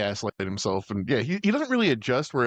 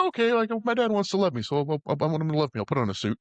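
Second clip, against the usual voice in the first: higher, eager innocent voice; lightly voiced naive Buddy; 5 semitones higher, faster than usual. Imitating Buddy wanting dad's love, lightly naive voice. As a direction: pitch it up like an innocent reasoning aloud.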